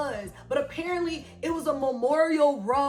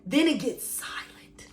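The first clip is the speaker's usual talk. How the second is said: hushed whisper, slow; breathy dramatic hush; 4 semitones lower, 5 dB quieter than usual. Acted. Dramatic hush about silent miles.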